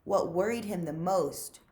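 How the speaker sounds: plain reading voice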